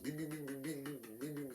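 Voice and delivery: soft, high-pitched comic beeps; rapid little electronic beeps, voiced by mouth; imitated beeping. Imitated noise for arousal.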